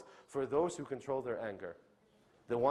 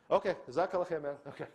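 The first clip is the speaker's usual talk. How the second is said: dismissive mock brush-off; 5 semitones higher than usual. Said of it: "after they say it".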